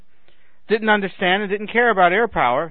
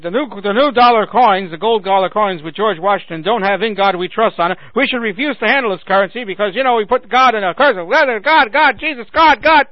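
rising, louder mock outrage; mocking zealot voice; 3 semitones higher, 6 dB louder than usual. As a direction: ham up a preachy complainer. Mocking a religious complaint.

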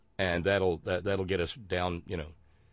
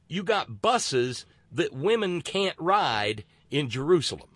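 slow, drawn-out sarcastic emphasis; mocking sarcasm; 4 semitones higher, 4 dB louder than usual. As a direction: lean hard and slowly on each mocking phrase. Sarcastic mock disgust at sexism.

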